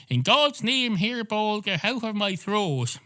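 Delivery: a cat playing priest over its own hairball; mock exorcism. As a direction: cast the hairball out like a hammy exorcist.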